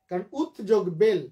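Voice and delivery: usual speaking voice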